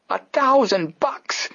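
high, slow wailing disbelief; astonished exclaim. Acted. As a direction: drag it out in stunned wonder. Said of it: "exclaimed Detective Smuff".

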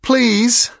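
loud, slow desperate plea; pleading out loud. A narrator's reading. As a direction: strain and beg, high and loud. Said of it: "she said out loud".

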